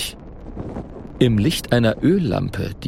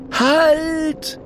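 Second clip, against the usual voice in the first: very high urgent shout; shouted warning. A narrator's reading. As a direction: stop them dead with a high cry. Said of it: "ruft Merere hinter ihnen her".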